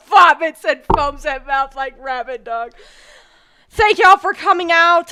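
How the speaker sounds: hammed-up celebrity. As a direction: play a showboating celebrity. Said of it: "let's just change the vibe".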